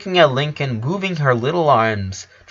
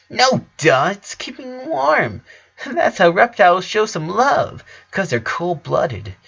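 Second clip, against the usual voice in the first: put-on young girl voice; 8 semitones higher than usual. Little sister explains, high.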